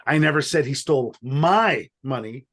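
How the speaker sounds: "my" stressed, the others light. Shown as a demonstration. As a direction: push the word "my". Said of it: "depending on which word I emphasize".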